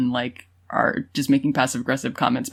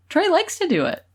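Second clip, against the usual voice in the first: high-pitched quote; 14 semitones higher than usual. Retold or imitated. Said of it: "she's like".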